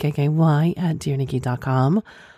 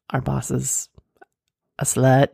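ordinary talk, nothing marked

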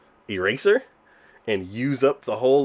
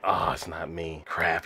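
low, soft, let-down mumble; mock dejection; 7 semitones lower, 7 dB quieter, slower than usual. Boy sees it's not his initial, quietly dejected imitation. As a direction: sink into it, acting out his disappointment. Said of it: "kinda getting all depressed".

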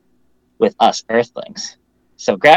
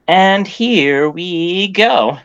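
drawn-out announcement; 8 semitones higher, 5 dB louder, much slower than usual. Pretend. Host kicks off the review.

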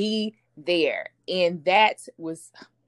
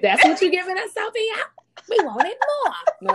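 high, loud teasing demand for more; pitched up, playful disappointment; mock complaint; 12 semitones higher, 4 dB louder than usual. Host teases Sophia's weak answer, loud and high mock demand.

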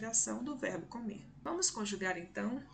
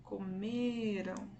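"Comeram" slowly, with a long ME; stress on middle syllable; much slower than usual. Shown as a demonstration. Teacher showing the strongest syllable, long stressed "me" in "Comeram". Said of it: "A sílaba mais forte será a sílaba do meio".

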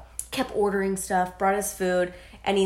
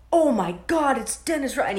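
starstruck fan; 5 semitones higher than usual. Retold or imitated. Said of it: "every person that saw him was like".

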